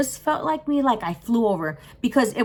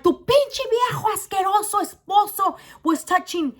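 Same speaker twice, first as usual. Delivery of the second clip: high, raised-voice accusation; acted outrage; 9 semitones higher than usual. Teller telling the wife about her husband, outraged. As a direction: shout the accusation in indignation.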